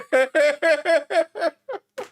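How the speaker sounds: high laugh. Real, not acted. Laughs at the Chud nickname.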